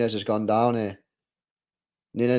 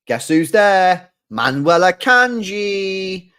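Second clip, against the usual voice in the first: louder, drawn-out hype call of the name; hyped shout-out; 5 semitones higher, 8 dB louder, much slower than usual. Real, not acted. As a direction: announce the player like a big reveal. Hyping a player on screen.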